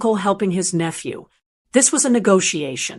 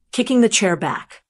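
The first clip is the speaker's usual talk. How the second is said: ordinary delivery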